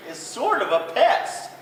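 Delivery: high, loud patient moose; a good sport putting up with pests. Acted. Moose grumbles kindly.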